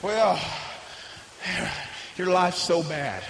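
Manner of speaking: low, quiet, insinuating voice; devil's whisper. Retold or imitated. The devil telling a believer their life is bad, low and insinuating. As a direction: slip the lie in softly. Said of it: "He wants you to start thinking that".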